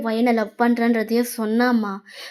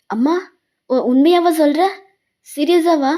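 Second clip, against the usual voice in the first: higher, louder incredulous questions; surprised disbelief; 4 semitones higher, 4 dB louder than usual. Acted. Son hearing his mother agree to the marriage, amazed. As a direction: ask it as if you can hardly believe it.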